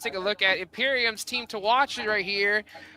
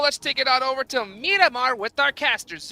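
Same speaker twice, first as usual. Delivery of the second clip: ordinary talk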